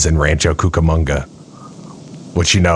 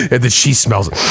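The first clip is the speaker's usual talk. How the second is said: ordinary delivery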